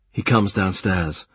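usual speaking voice

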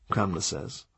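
ordinary delivery, nothing marked

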